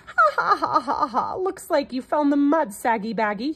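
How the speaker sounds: high, loud mocking cackle; screeching laugh. A narrator's reading. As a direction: laugh in the elephant's face. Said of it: "he screeched".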